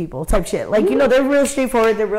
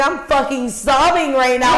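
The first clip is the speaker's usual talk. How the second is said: higher, heightened retelling of being in tears; hammed-up emotional exclamation, pitched up; mock sobbing outburst; 5 semitones higher, 4 dB louder than usual. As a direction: play up being a total wreck. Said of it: "I'm crying".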